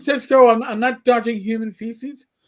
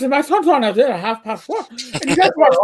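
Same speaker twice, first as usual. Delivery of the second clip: thick Donegal brogue; 4 semitones higher than usual. Retold or imitated. Captain's Irish mother talks about the news, thick Donegal brogue. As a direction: gabble it in a thick Irish accent. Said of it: "mother talked like this".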